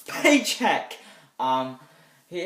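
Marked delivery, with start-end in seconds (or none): 0.1-1.0 high, loud mock-careless Bruce Willis, much higher on paycheck; mock bored star. Reviewer mocking a star who doesn't care, in a loud high mocking voice. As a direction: sneer it out like an actor who only wants the money. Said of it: "he just felt like".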